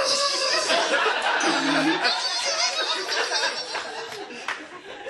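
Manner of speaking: a loud high laughing burst; laughing fit. Real, not acted. Comic cracking up at Carlos at the funeral.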